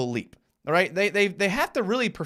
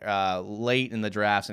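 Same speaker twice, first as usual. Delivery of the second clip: ordinary delivery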